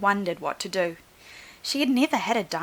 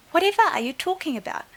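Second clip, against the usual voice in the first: high, loud, fast puzzled question; puzzled exclaim; 9 semitones higher, 6 dB louder, faster than usual. Acted. Teacher baffled by Clara's news, puzzled exclaim. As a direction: blurt out the question in surprise. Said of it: "Madame Lucille asked Clara".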